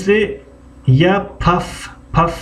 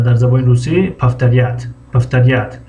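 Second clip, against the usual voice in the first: ordinary talk, nothing marked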